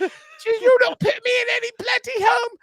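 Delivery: indignant English mum refusing; high squeaky British mum protest; mom's accent. Retold or imitated. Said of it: "you can't do my mom's accent".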